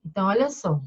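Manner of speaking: ordinary talk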